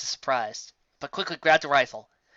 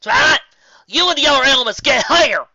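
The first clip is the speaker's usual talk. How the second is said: high, loud shout; 11 semitones higher, 12 dB louder than usual. Acted. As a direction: yell orders over the battle. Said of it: "she shouted".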